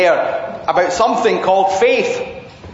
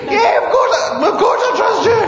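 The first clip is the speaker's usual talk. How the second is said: eager reply; 12 semitones higher than usual. Acted. Man's eager, nervous reply.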